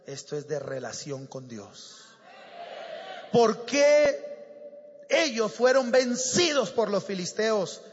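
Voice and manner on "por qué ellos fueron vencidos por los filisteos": raised, shouted opening question; forceful preaching. Real, not acted. Preacher asking why Israel was defeated, forceful shout. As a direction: throw the question out to the whole church.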